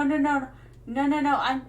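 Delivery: ordinary delivery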